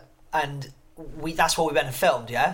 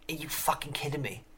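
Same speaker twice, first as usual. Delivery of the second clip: retold in a near-whisper, stunned; hushed disbelief; 8 dB quieter than usual. Guest recalls answering fans who believed it fake, hushed disbelief. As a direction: drop to an incredulous whisper. Retells his disbelief at fans' reaction.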